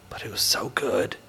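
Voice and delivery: whispered, savoring emphasis; hushed relish. Real, not acted. Recalling Derek's prime rib to co-hosts, hushed relish. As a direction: drop to a reverent whisper.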